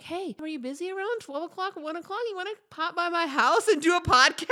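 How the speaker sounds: high, eager pitch to a friend, building loud; selling it. Retold or imitated. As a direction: pitch the invite brightly, ending in a laugh. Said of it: "that's me trying to sell it".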